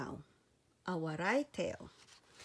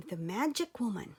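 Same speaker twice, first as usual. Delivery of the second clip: plain reading voice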